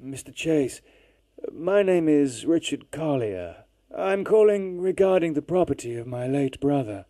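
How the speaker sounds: distant phone voice. Acted. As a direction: sound like a faint long-distance call. Caller on phone.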